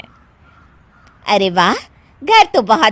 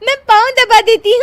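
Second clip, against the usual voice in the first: high and louder, bubbling with laughter; laughing; 10 semitones higher, 7 dB louder than usual. Acted. Daughter-in-law offering to press feet, laughing. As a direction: offer help while giggling.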